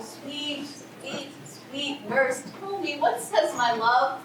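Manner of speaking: soft, slow, sweet-talking plea; coaxing. Acted. Juliet begging nurse for her love's message, sweetly coaxing. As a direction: coax her gently, drawing out each word.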